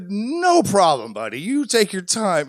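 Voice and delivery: sweet as pie while seething; over-the-top polite. Retold or imitated. To the Uber agent putting her on hold, exaggerated politeness. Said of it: "the nicest, most ridiculous, over-the-top, polite".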